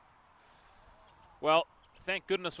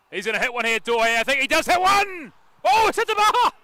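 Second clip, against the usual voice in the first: full-voiced, very high commentator scream; excited goal-chance shout; 13 semitones higher, 15 dB louder than usual. Real, not acted. Commentator sees a shot hit the bar, shouting excitedly. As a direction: build from loud urgency to a high yell.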